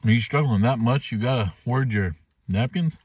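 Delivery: ordinary talk, nothing marked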